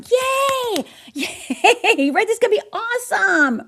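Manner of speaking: high squealing mock cheer; cheering yay. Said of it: "in heaven going".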